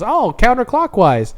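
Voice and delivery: put-on kid voice. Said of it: "And he goes".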